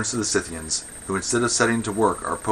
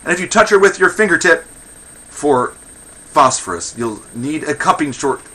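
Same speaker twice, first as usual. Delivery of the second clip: high, loud, rapid warning; fierce threat; 7 semitones higher, 9 dB louder than usual. Acted. Woman warning an archer off her friend, fierce threat. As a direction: fire off a shrill-pitched stage threat.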